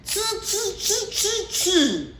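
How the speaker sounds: high, playful mocking monkey tsk-tsk; monkey chatter. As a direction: mimic the peddler like cheeky monkeys. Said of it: "shook their fingers back at him".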